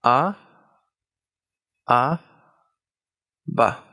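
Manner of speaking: ordinary delivery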